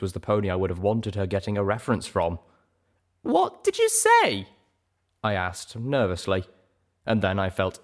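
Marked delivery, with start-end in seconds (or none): 3.2-4.5 high, nervous filly voice; high young mare voice. Acted. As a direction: squeak it out nervously.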